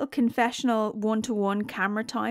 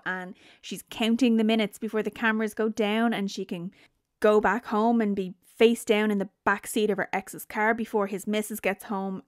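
ordinary delivery

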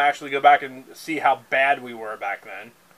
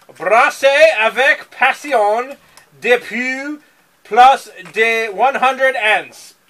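hammed-up French accent, higher, louder and slow; mock French accent; 7 semitones higher, 7 dB louder, much slower than usual. Reading the French on the beer can aloud in a hammed-up French accent. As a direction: read the label like an over-the-top Frenchman, drawing it out. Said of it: "There is French on here".

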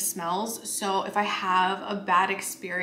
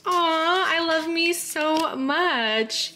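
delighted aww; 8 semitones higher, much slower than usual. Real, not acted. Touched by her own card.

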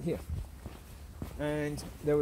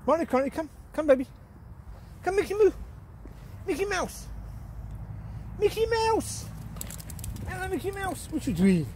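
calling the dogs; 14 semitones higher than usual. Real, not acted. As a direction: sing out to the dogs. Calling dogs, pet names.

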